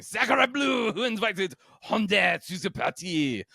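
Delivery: high, slow, hammed-up French accent. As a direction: shout it like an outraged Frenchman. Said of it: "I love my terrible accents".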